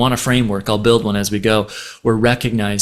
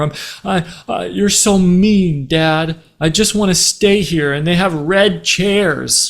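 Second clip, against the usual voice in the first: put-on higher, slow childlike whine; whiny daughter; 6 semitones higher than usual. Daughter whining that dad is mean, slow and higher. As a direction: pout it out like a little girl.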